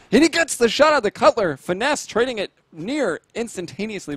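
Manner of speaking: high, loud burst of live commentary; excited play-by-play. Real, not acted. Caster calling a kill and trade live, excited. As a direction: jump up in pitch and volume as the kills land. Caster calls kills as they happen.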